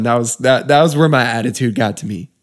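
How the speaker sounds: ordinary talk, nothing marked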